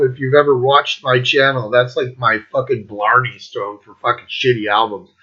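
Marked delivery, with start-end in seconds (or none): none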